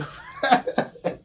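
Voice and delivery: squealing high-pitched laugh. Real, not acted. Laughing at accusation.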